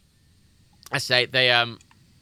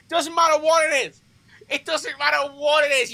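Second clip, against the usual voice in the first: higher, insistent repetition; raised exasperated repeat; 12 semitones higher than usual. Real, not acted. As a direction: hammer the point home in exasperation. Emphatic repeat while ranting.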